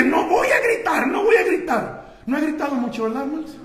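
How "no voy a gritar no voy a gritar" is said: raised, loud protest against shouting; comic loud vow. Acted. Preacher jokes about his own shouting, comically loud. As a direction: shout the promise not to shout. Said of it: "¿y por qué gritas?".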